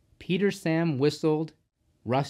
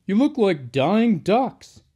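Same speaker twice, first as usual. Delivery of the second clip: higher, louder, slower driver voice; teasing driver; 7 semitones higher, 7 dB louder, slower than usual. A narrator's reading. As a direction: tease the gloomy engines loudly. Driver teases the sad engines.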